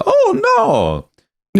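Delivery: very high, squeaky Mario cry; Mario game voice. Retold or imitated. Recalling the N64 Mario face game, squeaky Mario voice. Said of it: "Mario sixty-four".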